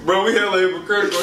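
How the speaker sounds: ordinary delivery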